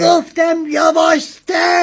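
high, insistent scolding; exasperated order. Acted. Passenger tells driver to slow down, exasperated order.

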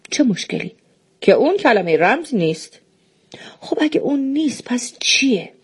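hushed whispered exchange; whispering. Acted. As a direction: drop to a whisper as if sharing a secret. Secretive exchange.

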